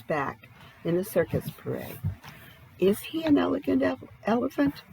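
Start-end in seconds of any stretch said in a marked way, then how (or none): none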